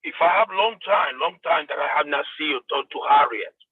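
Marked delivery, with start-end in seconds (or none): none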